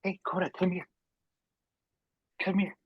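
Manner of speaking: high, soft coaxing call; real pet call, high and gentle; calling a dog. Real, not acted. Calling own dog over off-mic.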